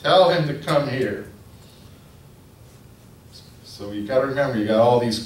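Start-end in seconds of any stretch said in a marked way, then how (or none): 0.0-1.3 high, loud call to summon; commanding call. Retold or imitated. Jesus calling the beggar over through the crowd, firm loud call. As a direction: stop and call him over firmly. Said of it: "So Jesus says".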